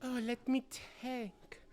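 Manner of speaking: put-on high, girlish, anxious noblewoman; young lady voice. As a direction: ask about papa like a worried girl.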